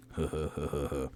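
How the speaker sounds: low, soft animal-like huffing; breathy low huff huff huff; panting huffs. Acted. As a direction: pant like a creature on all fours. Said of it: "on all fours ... and going".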